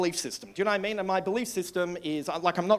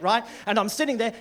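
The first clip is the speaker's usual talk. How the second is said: ordinary talk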